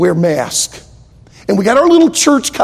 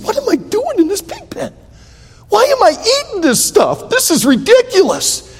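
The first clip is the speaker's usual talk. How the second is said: high, incredulous cry of the prodigal son; a young man fed up with the pig pen, pitched up high; high and disbelieving, in the pig pen; prodigal son's disgust; 14 semitones higher than usual. Acted.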